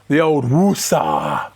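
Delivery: calming woosah. Pretend. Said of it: "practice anger management and all that".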